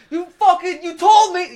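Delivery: high, loud angry yell. Retold or imitated. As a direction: yell it like a furious noob. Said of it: "I started yelling".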